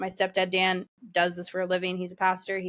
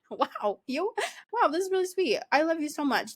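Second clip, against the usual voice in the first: much higher, sing-song fake awe, ending in a laugh; mock gushing; 5 semitones higher than usual. Teasing husband for crying in front of guests, high mock gush. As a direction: gush at him like it's over the top, then crack up.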